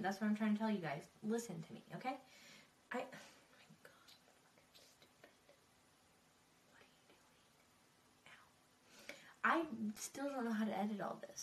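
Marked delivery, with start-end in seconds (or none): 2.9-8.5 whispering. Real, not acted. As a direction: hiss at yourself under breath. Muttering at own mistake.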